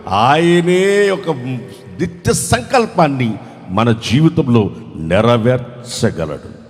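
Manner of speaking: usual speaking voice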